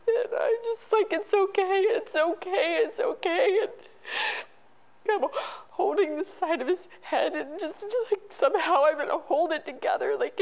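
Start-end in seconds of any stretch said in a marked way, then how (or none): 0.1-5.3 higher, louder, sobbing reassurance; repeating it through real tears; crying. Real, not acted. Mother reliving comforting her dying son, crying.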